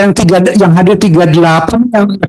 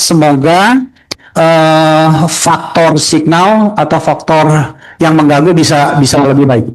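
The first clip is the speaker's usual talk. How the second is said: deliberately slow speech for a bad line; slow each word so it comes through; 3 semitones lower, slower than usual. Real, not acted. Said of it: "kami akan bicara diperlambat". (bicara diperlambat)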